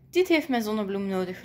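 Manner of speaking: plain reading voice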